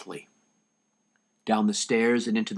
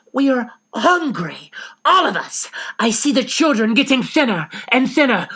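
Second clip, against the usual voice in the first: fierce reproach; 13 semitones higher, 7 dB louder than usual. Acted. Missus Frank shaming Van Dan for starving the children, fierce reproach. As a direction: shame him for starving the children.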